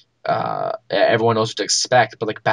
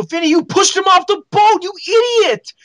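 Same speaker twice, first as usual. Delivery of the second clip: high, loud accusing yell; outraged shout; 6 dB louder than usual. Retold or imitated. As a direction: shout the blame at your cousin in alarm. Retold scolding of his cousin.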